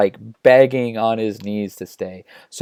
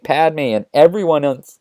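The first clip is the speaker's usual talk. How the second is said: ordinary delivery, nothing marked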